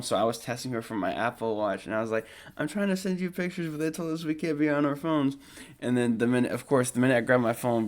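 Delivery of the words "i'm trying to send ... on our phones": high pleading voice. Retold or imitated. Texting girlfriend from his watch, high voice. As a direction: explain it in a high, helpless voice.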